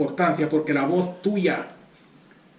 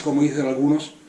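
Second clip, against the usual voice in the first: normal talking voice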